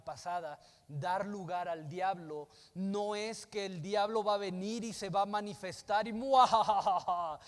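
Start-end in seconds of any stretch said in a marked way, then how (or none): 6.1-7.4 loud mock devil laugh; evil cackle. Mocking image of the devil.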